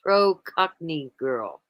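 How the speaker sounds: slow reading of a chat message; slowly read out. Real, not acted. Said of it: "Nona says".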